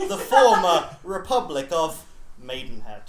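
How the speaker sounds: grand intro that cracks up; mock trailer narrator. Mock epic narration, laughing.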